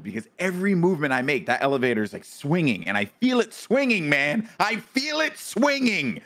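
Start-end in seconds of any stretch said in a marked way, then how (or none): 2.8-4.5 higher, alarmed retelling; acted panic. Acting out panic in elevator. 4.6-6.2 high, slow, drawn-out alarm; heightened acted panic. Repeats, panic escalating.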